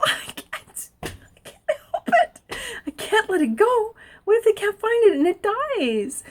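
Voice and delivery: high, whiny plea; pleading protest. Retold or imitated. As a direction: beg them to understand, voice climbing up. She pleads with the sheriffs.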